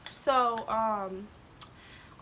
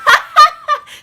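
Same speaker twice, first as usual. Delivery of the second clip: high, loud squealing laugh; real burst of laughter; 18 dB louder than usual. Real, not acted. Recalling the teacher's defence, bursting into a loud squealing laugh.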